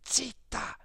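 hissed Zitta, unvoiced and sharp; harsh whisper. A narrator's reading. The priest hushes Perpetua with a harsh whisper. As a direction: cut her off in a sharp whisper. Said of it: "sempre però a voce bassa".

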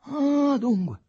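high, loud, drawn-out outrage; outraged exclamation. A narrator's reading. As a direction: cry out at being played with. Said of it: "esclamò Aurelio Costa". (esclamazione indignata, acuta e forte)

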